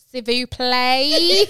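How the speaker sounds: laughing, exaggerated attempt at French; mock tourist French. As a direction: stretch out the French like an English holidaymaker. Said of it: "English people go to France and they're like".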